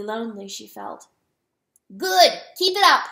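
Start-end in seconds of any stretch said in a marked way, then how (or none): 2.0-3.0 loud, high call of encouragement; shouted. A narrator's reading. Rooster urging the hen to keep calling, shouted. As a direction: shout approval across a distance.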